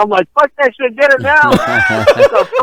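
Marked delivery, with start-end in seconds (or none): 0.3-1.5 defiant taunt. Retold or imitated. 1.5-2.6 high squeal through laughter; laughing. Real, not acted.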